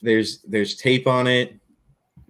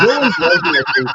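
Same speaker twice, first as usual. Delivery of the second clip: mock high voice; 12 semitones higher, 5 dB louder than usual.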